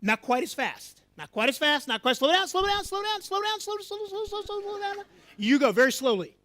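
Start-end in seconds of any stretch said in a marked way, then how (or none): 2.2-4.9 high-pitched, insistent calling out. Real, not acted. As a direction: rein them in with a high, urgent call. Coaching student to walk slower.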